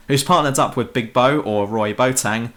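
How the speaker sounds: normal talking voice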